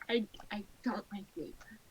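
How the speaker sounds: quick breathy confession; whispering. Real, not acted. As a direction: whisper it like a secret. Confessing quietly.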